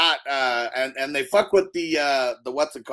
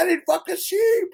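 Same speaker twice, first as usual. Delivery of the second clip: high yelp; 4 dB louder than usual. Retold or imitated. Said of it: "He said".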